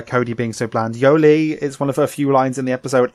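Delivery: ordinary talk, nothing marked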